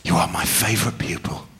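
low, hushed sincerity; earnest reassurance. Acted. Teacher reassuring his favourite pupil, low and earnest. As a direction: drop right down, measured and intimate.

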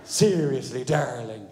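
slow, mock-admiring wife; drawn-out sarcastic compliment. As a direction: imitate a wife laying on fake praise. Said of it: "the most sarcastic woman on the planet".

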